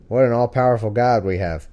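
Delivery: ordinary delivery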